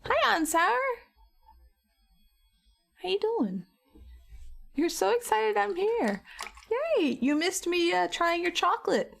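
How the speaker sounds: high, bright-eyed hello to the pet; excited greeting. Real, not acted. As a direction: greet him like a squealing fan.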